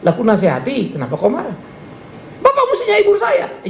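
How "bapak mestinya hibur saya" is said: much higher, plaintive voice; imitating whiny complainer. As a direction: whine to be comforted. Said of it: "dimarahin orang". (meniru jemaat merengek minta dihibur)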